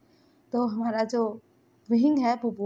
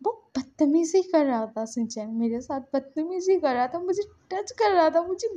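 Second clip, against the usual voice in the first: upset, pitched-up plea, mildly tearful; 6 semitones higher, 3 dB louder than usual. A narrator's reading. Lanson tells Shinchan he felt dirty, acted tearful distress. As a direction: voice rising louder in narrated distress. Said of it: "बोल के ना वो रो रहा था".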